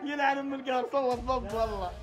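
laughing frustration. Real, not acted. Losing the round, frustrated.

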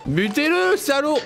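high urgent call; excited yell. Real, not acted. Urging to shoot.

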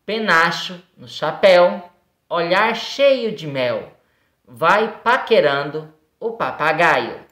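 slow, measured poem reading for pupils; poem recitation. Acted. Teacher reads a poem to pupils, slow recitation. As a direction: read it out slowly, phrase by phrase.

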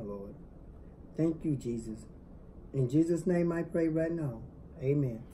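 soft, hushed prayer; grace before meal. Real, not acted. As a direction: pray quietly over the food. Husband prays over the food.

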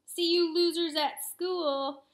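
slow, loud taunting call. A narrator's reading. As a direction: call back over your shoulder. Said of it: "Max called out over his shoulder".

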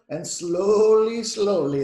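loud, stretched-out words; slow, drawn-out. A narrator's reading. Engine starting the train, drawn-out slowly.

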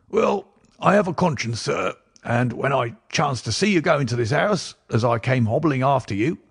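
put-on high, odd old man's voice, gruffly apologetic; croaking old book collector. Old book collector speaking in character.

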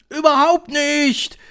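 high, loud, drawn-out crowd cry; slow, high bellow; bawling. A narrator's reading. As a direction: bawl it out like a rowdy coven. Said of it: "grölten sie durcheinander".

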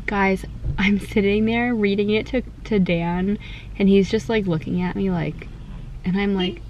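ordinary delivery

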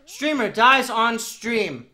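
slow, loud headline announcement; mock headline. Streamer announcing his own death as a headline, slow. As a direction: announce it like breaking news. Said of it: "what headline".

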